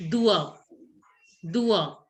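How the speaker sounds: "dua" as a model, repeated after a pause; French pronunciation. Shown as a demonstration. Teacher teaching French for fingers, pronunciation model. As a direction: model "dua" as "doo-ah", falling. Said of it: "Fingers are pronounced as".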